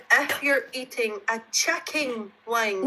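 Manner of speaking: slow, careful Scottish reading, chicken near "chucking"; laboured Scottish read-aloud; Scottish accent. Real, not acted. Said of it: "you're saying chucking".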